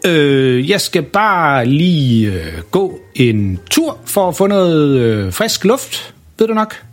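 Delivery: stalling excuse. A narrator's reading. Luca stalling his parents.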